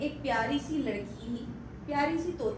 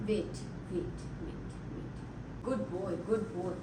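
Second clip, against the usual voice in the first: quiet, slow soothing of the bird; soft calming; 6 semitones lower, 7 dB quieter, much slower than usual. Real, not acted. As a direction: settle the pet gently. Calming parrot.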